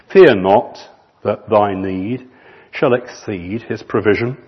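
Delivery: slow, measured recitation of a hymn; hymn verse reading. Acted. Pastor reading the hymn to his church, measured recitation. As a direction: read the verse like a poem to the congregation.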